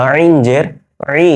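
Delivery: "আইন" and "ই" squeezed from the throat; drawn-out pharyngeal "আইন"; correct throaty ayn. Shown as a demonstration. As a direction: constrict the throat to start "আইন" and "ই". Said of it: "এজন্য এটা ভুল করা যাবে না।".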